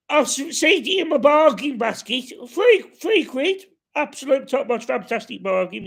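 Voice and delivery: high, loud put-on voice of a bargain-proud mate; Gary impression. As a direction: squeak it out like a chuffed bargain hunter. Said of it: "he'd probably say".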